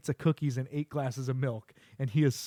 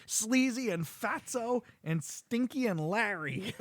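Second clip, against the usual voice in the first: silly wrong names; 8 semitones higher, slower than usual. Retold or imitated. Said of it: "He's like".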